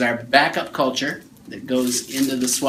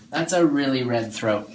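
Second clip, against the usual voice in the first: usual speaking voice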